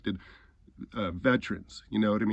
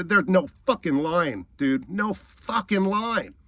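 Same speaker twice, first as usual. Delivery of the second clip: high, heated denial, last phrase drawn out; angry insistence; 6 semitones higher, 5 dB louder, slower than usual. Real, not acted. Ranting about a man's excuse, angry insistence. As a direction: insist on it, leaning hard on the swearing.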